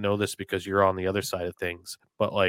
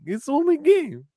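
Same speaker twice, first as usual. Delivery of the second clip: high, mock Russian accent, broken English; Ilya Bryzgalov impression; 6 dB louder than usual. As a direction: play the unbothered Russian goalie shrugging it off. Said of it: "I would act like is Ilya Bryzgalov".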